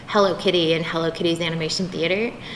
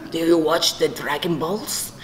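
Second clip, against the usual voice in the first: put-on low, slow Spanish-accented dad; slow question in a low Spanish accent; dad's Spanish accent; 4 semitones lower, slower than usual. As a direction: imitate a Spanish father asking carefully, pitched down. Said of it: "when I do the accent".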